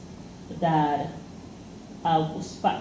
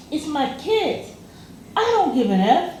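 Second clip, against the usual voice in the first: defiant retort; 8 semitones higher than usual. Retold or imitated. Woman snapping back.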